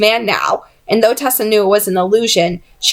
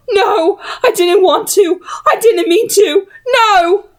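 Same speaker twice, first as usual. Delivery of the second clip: wailing; 11 semitones higher, slower than usual. A narrator's reading. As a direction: wail in despair. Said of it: "she wailed".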